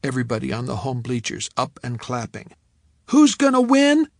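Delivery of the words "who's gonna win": high, loud cheerleader shout; cheerleader call. Acted. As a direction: call out to the whole stadium. Cheerleader calls to crowd.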